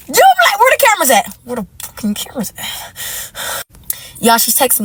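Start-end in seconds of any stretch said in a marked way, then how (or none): 0.1-1.4 shocked disbelief. Real, not acted. Disbelief at the news. 1.4-3.6 disbelieving whisper. Real, not acted. Muttered disbelief.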